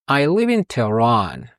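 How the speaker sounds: slow, careful English answer; teacher modelling slowly; slow model answer. Shown as a demonstration. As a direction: say each word clearly and slowly. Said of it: "مثلاً".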